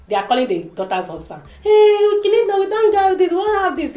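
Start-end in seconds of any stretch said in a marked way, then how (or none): 1.6-3.9 higher mock whine of a needy mother; lightly mocking a needy in-law's mother; mock demanding mother. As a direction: imitate a mother asking for things, pitched up.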